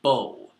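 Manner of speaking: bow said to rhyme with go. Shown as a demonstration. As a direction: say bow like the weapon, with an o sound. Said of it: "You can generally infer that that is".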